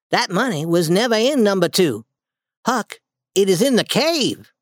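lightly voiced boy Tom. Tom's secret revealed to Huck.

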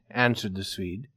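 plain reading voice

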